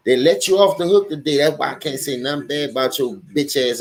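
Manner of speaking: normal talking voice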